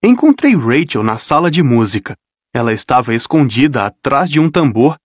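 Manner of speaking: ordinary delivery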